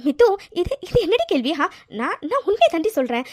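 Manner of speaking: high, stumbling protest; defensive and rattled; flustered denial. Acted. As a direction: deny it too quickly, caught off guard. Flustered denial when questioned by friend.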